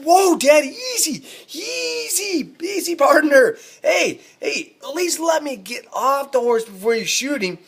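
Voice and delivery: very high-pitched, stretched-out mock pleading; hammed-up alarmed coaxing; panicked placating. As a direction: act out frantically calming someone down. Son calming his armed, angry dad.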